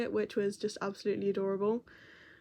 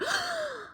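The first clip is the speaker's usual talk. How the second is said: sharp high excited gasp; delighted gasp. Retold or imitated. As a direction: gasp like thrilled kids. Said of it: "they both go".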